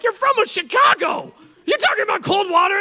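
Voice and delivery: high, loud disbelieving yell; mocking tourists afraid of cold water; incredulous shout. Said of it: "I'm like".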